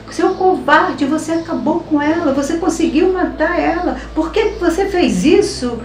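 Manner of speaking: high-pitched re-enacted reproach; retold angry accusation. Father-in-law accusing husband, retold.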